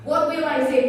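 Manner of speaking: ordinary talk, nothing marked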